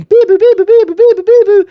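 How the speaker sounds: loud, high two-note siren noise; shrill out a siren with your voice; imitated siren. Narrator mimics sirens as the hoodies run in, loud wailing siren imitation.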